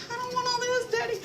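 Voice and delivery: put-on high, whiny little kid pleading with his daddy; child's voice, high and pouty; little boy voice. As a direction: play the small boy who doesn't want all that food. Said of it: "his face was like".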